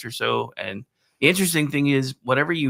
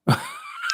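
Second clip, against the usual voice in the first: high squealing laugh. Real, not acted. Embarrassed laugh.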